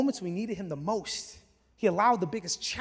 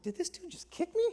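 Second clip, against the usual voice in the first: incredulous; 11 semitones higher, 5 dB quieter than usual. Retold or imitated. Husband wondering if a stranger kicked him, incredulous. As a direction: squeak it in baffled disbelief. Startled thought after being kicked.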